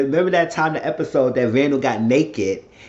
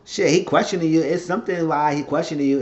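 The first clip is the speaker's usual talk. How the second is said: ordinary talk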